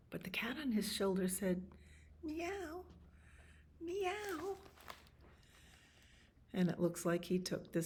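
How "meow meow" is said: very high, soft mewing; cat meow. Acted. Cat on his shoulder answers the man, high soft meows. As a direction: mew softly, high and small.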